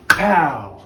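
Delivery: loud, high "Pow!" burst like a cracking rock; imitated rock crack. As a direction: punch out "Pow!" as the rock splits. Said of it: "and he goes".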